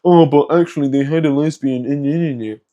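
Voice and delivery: whiny mock rebuttal dissolving into babble; mocking objector. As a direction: imitate an objector, trailing into nonsense syllables. Mimicking an imagined objector's argument.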